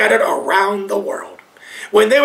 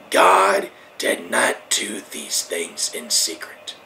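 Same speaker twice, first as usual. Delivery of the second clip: low and slow; 5 dB quieter, slower than usual. Real, not acted. Preacher drops voice.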